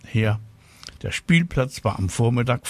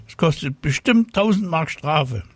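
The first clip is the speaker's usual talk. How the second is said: put-on high, know-all child's warning; called-out warning; 7 semitones higher than usual. A narrator's reading. Ilse threatening flying Tina with fines, calling up. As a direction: warn loftily in a raised pitch. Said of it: "rief Ilse ihr zu".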